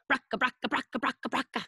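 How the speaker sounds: rattling "bracka" engine noise; old car rattle. Shown as a demonstration. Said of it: "Old cars sound like this".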